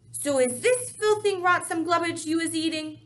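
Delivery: high, loud booming giant voice. Acted. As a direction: boom it out like a brutish giant. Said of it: "boomed the blood bottler".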